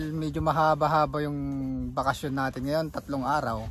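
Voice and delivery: usual speaking voice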